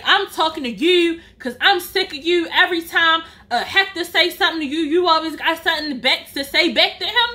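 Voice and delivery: usual speaking voice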